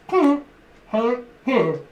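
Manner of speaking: high, loud mock-accent hellos; Polish shopper impression. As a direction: call out hello like a shopper at the till. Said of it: "they come in and they go".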